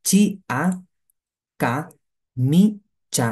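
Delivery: "কা, মি, চা" in three separated syllables. Shown as a demonstration. Teacher spelling out কামিচা for learners, syllable by syllable. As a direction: sound out "চি আ, কা, মি, চা" with pauses between. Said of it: "দেখেন".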